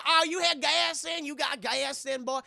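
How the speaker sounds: high, loud country drawl; hammed-up Southern twang, prying; cowboy stranger. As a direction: stretch it out like a nosy hillbilly. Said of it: "this guy in a cowboy hat".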